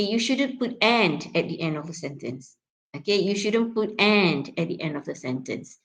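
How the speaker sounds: AND in strong form, full "a" vowel; weight on the cited word AND; stressed AND. Shown as a demonstration. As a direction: set AND apart with stress, as a quoted word. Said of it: "it's being stressed".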